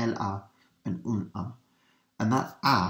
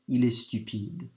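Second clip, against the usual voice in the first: ordinary talk, nothing marked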